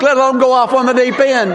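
ordinary talk, nothing marked

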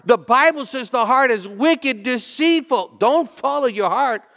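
loud, high, fiery pulpit warning; preacher shouting down a worldly slogan; preaching at full force. Acted. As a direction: thunder it at the congregation like a revival preacher.

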